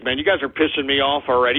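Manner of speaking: ordinary talk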